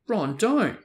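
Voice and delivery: sharp, high, loud rebuke; angry. A narrator's reading. Hermione scolding Ron, angry and sharp. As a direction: cut him off hard. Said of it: "said Hermione angrily".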